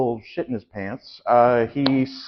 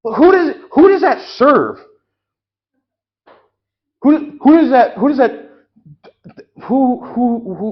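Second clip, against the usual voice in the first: outraged shout; 8 semitones higher, 6 dB louder than usual. Acted. Outraged customer questioning balloons.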